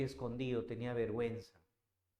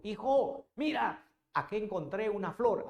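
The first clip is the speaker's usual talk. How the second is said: high, louder delighted call; delighted discovery; 7 semitones higher, 5 dB louder than usual. Acted. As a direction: show off a found flower with joy. Padre descubre una flor. (padre llama emocionado a su hijo con alegría)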